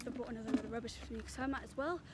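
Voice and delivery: breathless and puffing; out of breath. Real, not acted. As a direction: still panting while talking. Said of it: "I'm so out of breath".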